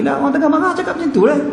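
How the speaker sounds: high-pitched, exasperated wife's excuse; mock indignant wife snapping back; angry wife. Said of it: "isteri tu memang tengah marah".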